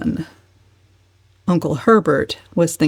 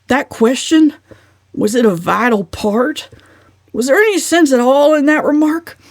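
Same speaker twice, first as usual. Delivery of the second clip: higher, louder blustering uncle; 6 semitones higher, 4 dB louder than usual. Acted. Uncle Benjamin lecturing the family, blustering.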